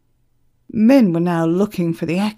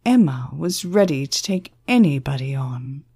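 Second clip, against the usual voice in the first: plain reading voice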